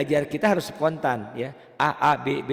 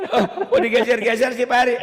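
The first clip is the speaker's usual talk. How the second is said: loud, high-pitched exasperated protest, half laughing; mock inner complaint; 10 semitones higher, 7 dB louder than usual. Teacher replaying his silent gripe to the student, mock exasperated. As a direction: complain loudly as if fed up, playfully. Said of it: "Saya bilang, Dalam hati".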